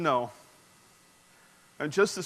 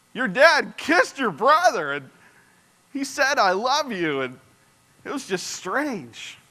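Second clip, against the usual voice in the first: higher, slow, stretched-out bewilderment; baffled disbelief; 6 semitones higher, slower than usual. Retold or imitated. Boyfriend asking about her dad kissing her brother, baffled disbelief. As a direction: draw out key words as if it makes no sense. Baffled teen questions his girlfriend.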